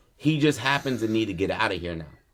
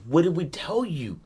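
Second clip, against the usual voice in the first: higher-pitched retold scolding; mock-frantic question, pitched up; frantic mother; 7 semitones higher than usual. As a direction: imitate a frantic mother's reproach. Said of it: "His mother is frantic".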